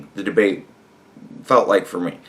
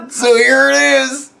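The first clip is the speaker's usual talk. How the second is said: high, loud gleeful announcement; 13 semitones higher, 5 dB louder than usual. Real, not acted. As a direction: present it in triumph. Gleeful as clip is played.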